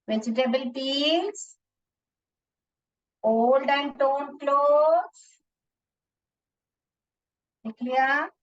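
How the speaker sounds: very slow with long pauses between items; slow dictation. Shown as a demonstration. Teacher dictating the answer while children copy it down. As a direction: dictate each item, wait for them to write. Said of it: "जल्दी से लिख लीजिए ये first question answer".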